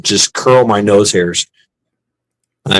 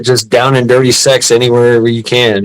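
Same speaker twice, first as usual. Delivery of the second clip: usual speaking voice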